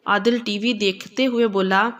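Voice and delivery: ordinary delivery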